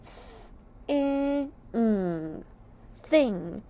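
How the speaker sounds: "Th-" breathed, "i-" short, "ng" hummed, then "Thing"; phonics sounds. Shown as a demonstration. Teacher sounding out thing for young readers, sound by sound. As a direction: isolate each sound, then join. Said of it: "Now let's sound out thing".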